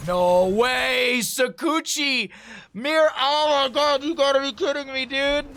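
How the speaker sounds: high, loud disbelieving shriek; shrieking jackpot winner. Real, not acted. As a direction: scream it like you just won big. Caster erupts at a play.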